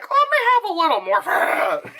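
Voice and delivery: high, strained pleading voice; laughing, exaggerated high wheedle; mock morphine craver. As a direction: beg for it in a hammed-up high voice. Imagined craving addict, acted.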